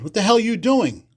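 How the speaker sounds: high, hard demand; mock mobster anger. Mobster confronts him about the gum machine, high and hard. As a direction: bark it like a mobster catching you.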